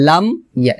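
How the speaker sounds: "লাম ইয়া" with m shut and a break before ya; closed m, separated. Shown as a demonstration. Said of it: "এভাবে".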